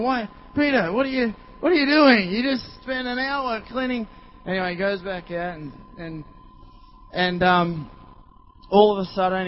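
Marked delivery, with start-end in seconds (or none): 0.0-4.1 high, raised mock-incredulous questioning; bystanders' disbelief. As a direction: play onlookers calling after a friend. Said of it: "everyone's going".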